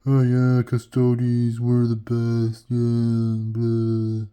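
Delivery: loud, droning boastful rival; hammed-up mocking blah-blah chant; mocking rival impression. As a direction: drone it out loudly, mocking a braggart. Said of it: "he's a big".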